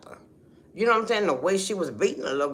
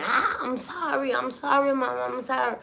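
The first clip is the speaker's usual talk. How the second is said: imitating child's pleading; 6 semitones higher than usual. Little girl begging her mother to stop, high mock pleading. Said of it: "the little girl just screaming".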